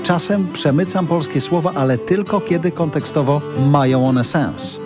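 polished announcer read over music; radio intro read. Acted.

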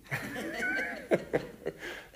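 a high, breathy burst of laughter. Real, not acted. Preacher laughs high at his own joke.

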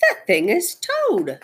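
high, slow realization; astonished. Acted. Frog discovering the monster is his friend, astonished. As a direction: pitch it up in amazed discovery.

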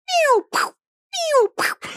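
high, loud pew pew noises; imitated firework. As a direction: mimic endless rockets. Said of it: "going nonstop".

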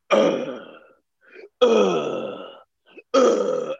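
put-on whiny drawn-out ugh, teen fed up with everything; mock teen groan. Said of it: "everything is like".

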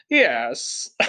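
lightly voiced dignified gentleman; understated gentlemanly gravity. A narrator's reading. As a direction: answer with a touch of put-on dignity. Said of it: "with dignity".

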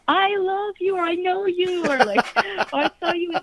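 fans greeting. Retold or imitated.

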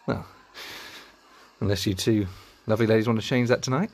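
ordinary talk

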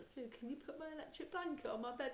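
butter mum up with a sweet request; sweet plea. Retold or imitated. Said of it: "and I said".

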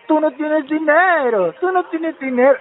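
high mocking imitation of Emma, slowed; imitating Emma. The prankster mimics Emma saying he has no money, high and mocking. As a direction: parrot Emma's taunt in a high voice. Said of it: "me decía como tú".

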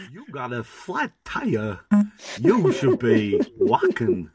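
Al Pacino. Acted. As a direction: draw out the last phrase. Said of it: "Al Pacino".

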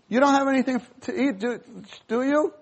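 as the fishermen heard it, a bit annoying; mock nagging. Fishermen hearing Jesus's call from the shore, mock irritated. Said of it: "You have to imagine, what were they thinking".

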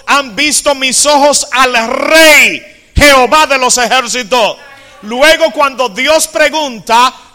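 high, loud, slow preached reading; fiery Bible reading from the pulpit; loud scripture reading. Acted. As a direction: proclaim the vision like thunder. Reading Isaiah 6 at full force.

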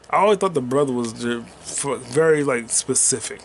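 ordinary delivery

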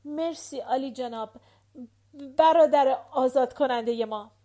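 loud, broken-up delivery with a long gap; halting words. A narrator's reading. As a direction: force it out in separate, emphatic pieces. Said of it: "با کلمات مقطع گفت".

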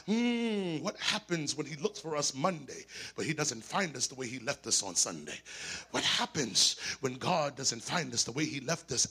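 ordinary delivery

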